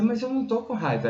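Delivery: high-pitched, quick protest; protesting denial. Retold or imitated. Husband insisting to his wife he is not angry, protesting denial. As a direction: retell a squeaky, defensive denial. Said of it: "Ela dizia".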